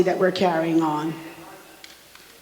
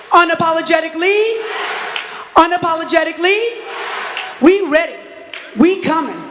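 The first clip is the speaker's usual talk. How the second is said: rally call and response; 6 semitones higher than usual. Acted. Activist leading the crowd in a protest chant, shouted. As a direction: rouse the crowd with each call.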